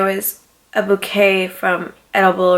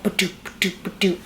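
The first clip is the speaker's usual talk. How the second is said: imitating techno beat; 6 dB quieter than usual.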